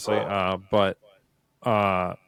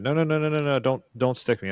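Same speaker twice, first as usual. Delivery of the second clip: panicked pleading; 6 semitones higher, 4 dB louder than usual. Retold or imitated. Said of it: "and she said".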